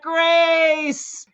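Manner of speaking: high, long-held mock holler; stretched, high-pitched call without real volume; retold yell back. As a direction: imitate hollering a name across a theater. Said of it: "He yells back".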